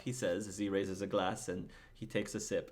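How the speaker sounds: normal talking voice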